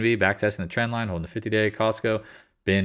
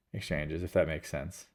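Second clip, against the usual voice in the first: usual speaking voice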